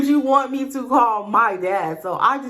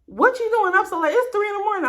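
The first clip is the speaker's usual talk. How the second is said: voicing dad, much higher pitch; dad on the phone; 12 semitones higher than usual. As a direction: imitate a dad questioning a late-night call. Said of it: "He was like".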